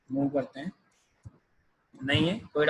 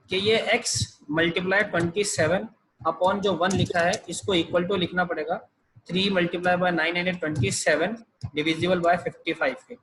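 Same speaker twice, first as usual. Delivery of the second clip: slow dictation; much slower than usual. Shown as a demonstration. Teacher dictates formula to copy.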